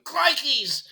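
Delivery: high-pitched mock Aussie yelp; falling man's cry.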